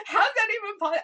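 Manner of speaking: high, loud laugh-cry; laughing through tears. Retold or imitated. Wife to husband, laughing and crying at once. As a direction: crack up mid-sob in disbelief.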